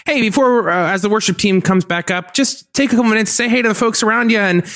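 imitating a perky, high-pitched church announcer; announcements guy. Announcements guy asking churchgoers to greet each other, mock-perky high voice. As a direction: chirp it out like a mock service host. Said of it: "the announcements guy's, like, up there".

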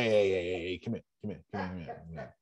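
quietly waving a friend over, dropping almost to a whisper; discreet hush. Retold or imitated. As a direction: pull them close and hush it. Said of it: "the friend though that's gonna say".

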